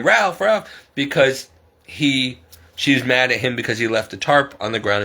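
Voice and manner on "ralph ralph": high-pitched mock yell of a name; imitated yell. Neighbor yelling at her boyfriend on speakerphone, mock yell. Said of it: "yelling at him".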